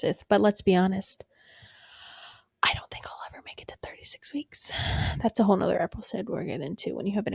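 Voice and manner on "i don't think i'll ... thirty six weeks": whispered aside. Real, not acted. Confiding aside about future pregnancy.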